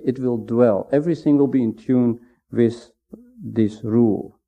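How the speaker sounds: soft and quiet. Shown as a demonstration. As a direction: dwell said softly and quietly, falling gently. Contrasting reign with dwell.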